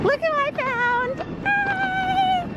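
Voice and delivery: squealing high, happy hello; delighted to see a friend; excited greeting. Real, not acted.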